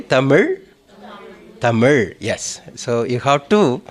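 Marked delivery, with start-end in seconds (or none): 0.0-0.6 TAMIL with the final L curled into zh. Shown as a demonstration. Teacher prompting students to repeat, TAMIL with curled zh ending. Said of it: "Say Tamil". 1.6-2.1 TAMIL with a curled-back zh ending. Shown as a demonstration. Teacher modelling it for students, TAMIL ending in curled zh. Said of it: "Say Tamil".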